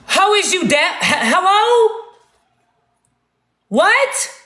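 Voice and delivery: high, rising, incredulous query; mock can't hear. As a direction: act out straining to hear, pitch leaping up. Acts out straining to hear whisperer.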